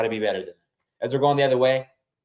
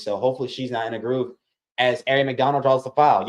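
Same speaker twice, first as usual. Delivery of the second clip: ordinary delivery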